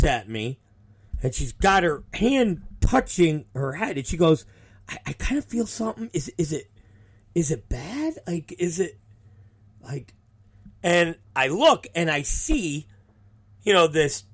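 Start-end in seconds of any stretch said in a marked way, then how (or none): 4.9-10.1 nervous asking. Retold or imitated. Wrestler touching her bleeding head asks if it's bad, nervous. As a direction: ask softly and haltingly, half afraid of the answer.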